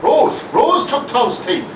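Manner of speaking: high, slow triumphant guess; excited realisation. Acted. Doctor finally cracks Margaret's charade, high and slow. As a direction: spell out the answer as it dawns.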